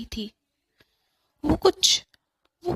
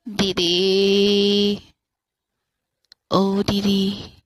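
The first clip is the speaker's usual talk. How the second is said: low, slow, drawn-out eerie call; a dead brother's ghost calling his sister; ghostly call; 4 semitones lower, 4 dB louder, much slower than usual. Acted. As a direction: stretch the name like a ghost calling.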